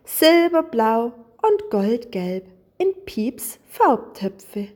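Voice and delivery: ordinary delivery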